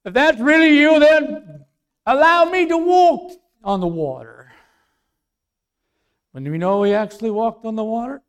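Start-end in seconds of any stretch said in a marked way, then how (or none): 0.0-4.5 loud, high plea to the Lord; preacher's full-voiced Peter; bold Peter calling out. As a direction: call across the water as Peter.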